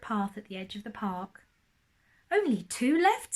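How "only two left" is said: lightly voiced Percy. Percy voiced in read story.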